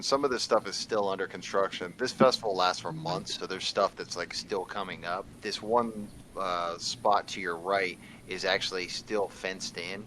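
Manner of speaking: ordinary talk, nothing marked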